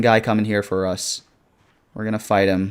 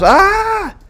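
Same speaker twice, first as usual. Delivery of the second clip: real jump scare yelp, high and loud; startled shriek at the zombies; scared scream; 10 dB louder than usual. Real, not acted.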